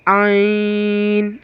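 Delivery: आइन kept thin, not heavy, held long; slow light आइन; light ain. Shown as a demonstration. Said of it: "आइन को मोटा नहीं पढ़ना है".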